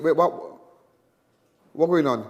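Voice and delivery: ordinary delivery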